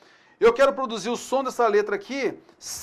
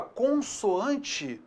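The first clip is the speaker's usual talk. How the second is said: "consoante" very slowly, dwelling on "con"; drawn-out word; 2 semitones higher, 5 dB quieter than usual. Shown as a demonstration. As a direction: stretch out "consoante" to show its parts. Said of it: "você vai sempre se lembrar".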